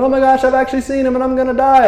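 high-pitched rushed mock freakout; mock panic. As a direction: gabble it out in hammed-up fright.

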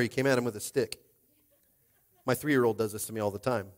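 ordinary talk, nothing marked

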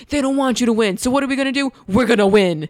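rally them like a hype man. Retold or imitated. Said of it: "And it was always like".

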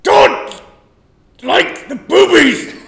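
loud, halting hammed-up outburst; slow mock-furious yell; angry. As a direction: force it out word by word as a furious doctor. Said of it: "Why are you so angry?".

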